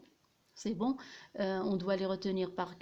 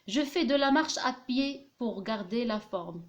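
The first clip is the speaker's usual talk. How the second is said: slow dictation reading; 2 semitones higher, much slower than usual. Shown as a demonstration. Dictating the example sentence.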